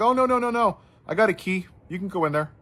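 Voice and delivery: clerk's quick, eager high no no no; eager reassurance. Retold or imitated. Counter guy reassures him eagerly.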